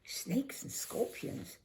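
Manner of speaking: slow, breathless whisper of alarm; a girl startled by talk of snakes. A narrator's reading. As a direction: gasp the words out, frightened. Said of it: "I flinched".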